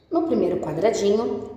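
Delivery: ordinary talk, nothing marked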